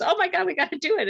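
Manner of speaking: mock-thrilled high exclamation; excited yes. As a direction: rush it out like you're instantly on board. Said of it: "and he goes".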